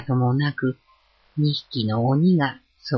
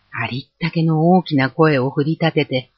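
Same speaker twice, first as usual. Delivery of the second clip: plain reading voice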